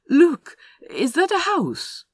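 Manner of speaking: high, loud, drawn-out excited question; excited discovery. A narrator's reading. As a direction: cry out in sudden hope. Spots a house at last.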